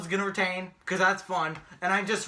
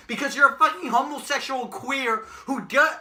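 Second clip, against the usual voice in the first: ordinary delivery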